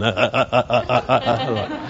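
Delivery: low laughing imitation of a baby's wail; mock baby crying. Preacher imitating his baby's crying, laughing. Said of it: "start going".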